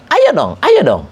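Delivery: insistent high urging, ayo dong pressed hard; urgent pushing. Shown as a demonstration. Teacher demos an urgent demand. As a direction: push them, serious and pressing. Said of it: "at-talabu bihisin wa iz'ajin".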